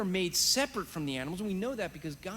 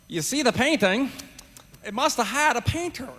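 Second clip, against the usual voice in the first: mock New Zealand accent, higher and louder; a fond, slightly botched New Zealand accent impression; imitating Ray Comfort; 5 semitones higher, 7 dB louder than usual. Preacher imitates Ray Comfort for the audience, in a mock New Zealand accent. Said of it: "I can't get the accent right".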